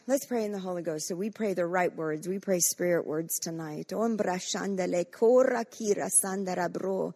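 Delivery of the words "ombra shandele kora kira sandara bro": fervent stream of prayer syllables; rising, rhythmic prayer in tongues; praying in tongues. Real, not acted. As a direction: pray it out in tongues, building.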